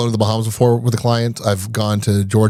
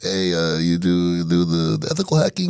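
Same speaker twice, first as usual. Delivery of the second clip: lower, halting mock Brazilian accent; hesitant caller with a Brazilian accent; 5 semitones lower than usual. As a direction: imitate a thick Brazilian accent, rising at the end. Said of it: "very thick Brazilian accent".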